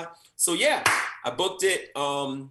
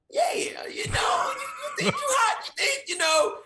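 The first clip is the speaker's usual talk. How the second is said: Gary Oldman impression. Imitating Gary Oldman's menacing talk.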